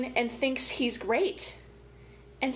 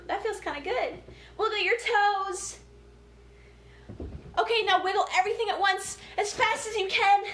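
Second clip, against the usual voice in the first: energetic movement leader; 7 semitones higher than usual. Acted. Librarian leading kids through wiggles, high and bouncy. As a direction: pump the kids up as you lead the wiggles.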